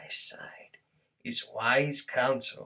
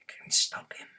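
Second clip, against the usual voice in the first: ordinary delivery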